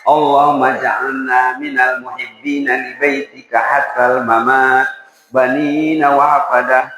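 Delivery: slow, drawn-out recitation; slow group recital. Shown as a demonstration. Congregation recites the prayer slowly together with the preacher. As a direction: stretch each word so all can follow. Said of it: "Ayo dibaca sama-sama".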